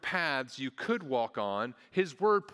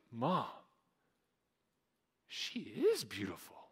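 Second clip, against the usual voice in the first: lightly voiced child in wonder; drawn-out, slow marvel of a small boy; awestruck little boy; 3 dB quieter, slower than usual. Imitates awestruck little nephew at rehearsal.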